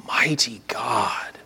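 whispered, slow and awed; hushed whisper. Acted. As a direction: drop to a whisper of reverence. Reading Isaiah's names of Christ.

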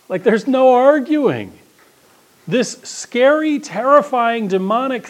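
high and loud, slow and emphatic; excited. Real, not acted. Preacher marvelling at the demon's defeat, high and slow. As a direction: build it up with awe.

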